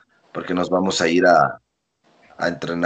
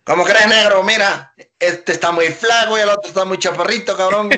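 high, loud Acapulco-style rant; mocking Acapulco accent; 12 semitones higher, 7 dB louder than usual. Promoter scoffing at the skinny newcomers, loud Acapulco accent. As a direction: blurt it out in a loud coastal Acapulco voice. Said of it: "así hablan los acapulqueños".

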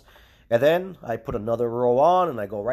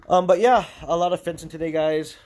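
loud, raised voice outdoors; shouting over wind; 5 semitones higher, 5 dB louder than usual. Real, not acted. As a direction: call out over the gusts. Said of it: "the wind is so strong today".

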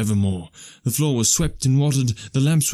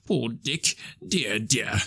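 softer, sighing sympathy; fond pity; 6 dB quieter, much slower than usual. Acted. Scrooge remembers poor Dick, soft and pitying. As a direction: lament gently for an old friend. Scrooge fondly pities old friend.

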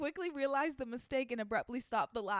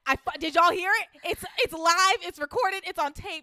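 loud, gleeful gotcha; excited; 5 semitones higher, 10 dB louder than usual. Real, not acted. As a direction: crow about catching her out. Excitedly gloating, caught on tape.

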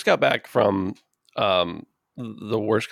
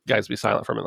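normal talking voice

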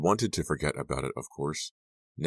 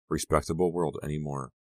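ordinary delivery

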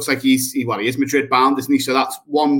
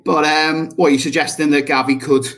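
ordinary talk

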